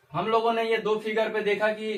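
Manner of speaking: usual speaking voice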